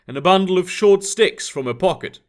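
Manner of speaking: ordinary delivery, nothing marked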